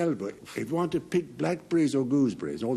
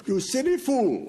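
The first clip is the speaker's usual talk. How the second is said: higher-pitched mock reproach; mock scolding; 8 semitones higher than usual. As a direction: scold them in jest for falling for it.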